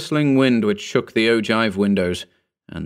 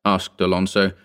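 usual speaking voice

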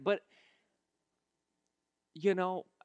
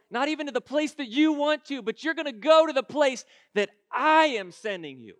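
raised preacher's voice speaking as God; emphatic preaching; 7 semitones higher, 9 dB louder than usual. Acted. God tells you where He is sending you, emphatic preaching. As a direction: press every phrase on the congregation.

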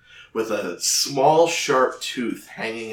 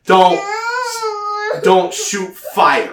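put-on giant child scolding; 5 semitones higher, 7 dB louder than usual. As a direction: scold like a bossy giant little girl. Giant girl scolds grandpa.

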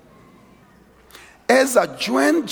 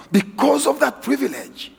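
"because" said higher and hit hard; stress on because; 7 semitones higher than usual. Shown as a demonstration. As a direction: punch "because" at the start of the phrase. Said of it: "But because of that privilege".

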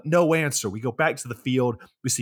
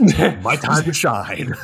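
slow, louder, drawn-out theatrical line; mock diva; 2 semitones higher, 7 dB louder, much slower than usual. Thumbelina offstage before her monologue, slow hammy diva. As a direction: savour it like a star about to enter.

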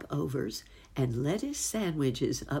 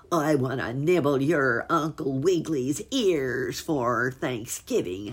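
howling fox; 6 dB louder than usual. Acted. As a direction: howl it out like a hungry bad fox. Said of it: "howled Fox".

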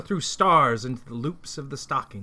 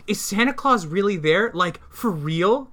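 higher, eager disbelief, slowing on the last words; excited cry; 6 semitones higher than usual. Acted. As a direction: ask like a thrilled kid, then stretch it out. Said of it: "cried Shade".

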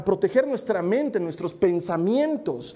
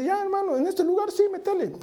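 mock eager approval; 10 semitones higher than usual. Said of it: "vamos a abrir iglesia".

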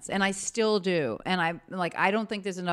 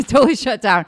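laughing; 6 semitones higher, 11 dB louder than usual. Real, not acted. Laughing about never crying.

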